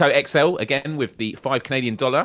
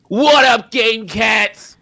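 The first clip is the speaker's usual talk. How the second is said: high, loud generic American hype voice; shouted, badly faked American impression; 13 semitones higher, 8 dB louder, much slower than usual. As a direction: belt out a hammed-up streamer greeting. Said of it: "me doing him, it's just like".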